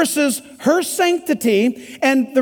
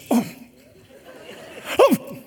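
tempted sigh; 5 dB quieter than usual. Retold or imitated. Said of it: "He'll go".

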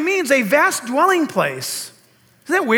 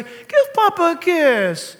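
higher, slow, drawn-out mock coaxing; coaxing grandma; 5 semitones higher, slower than usual. Grandma tells a granddaughter to kiss Papa, coaxing. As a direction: imitate a grandma coaxing, stretching each word. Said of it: "Lisa will say".

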